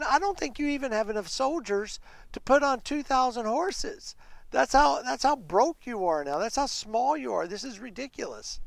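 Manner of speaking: high-pitched, scoffing put-down; mocking. Commander sneering at a small army, mocking. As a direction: belittle them in disbelief. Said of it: "He is so mocking. He's so ugly".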